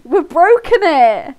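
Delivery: high, loud, drawn-out laughing outburst; excited shout. Real, not acted. Streamer shouting as the story game breaks. Game glitch repeating lines.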